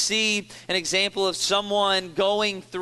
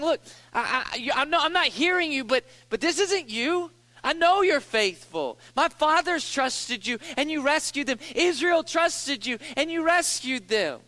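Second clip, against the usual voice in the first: higher, intense pleading; urgent plea; 7 semitones higher than usual. Retold or imitated. David arguing with God, higher urgent pleading. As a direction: press God with his own history.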